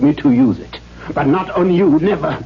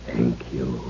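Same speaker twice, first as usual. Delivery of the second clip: softly whispered, menacing; chilling whisper; 10 dB quieter than usual. Acted. Father taking the walking stick from Katrine, whispered menace. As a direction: whisper your thanks like a threat.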